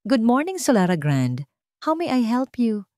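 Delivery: lightly voiced courteous hotel receptionist; a hint of a cheerful front-desk greeting; polite receptionist. A narrator's reading. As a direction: answer the phone with polished politeness. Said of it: "magalang na boses".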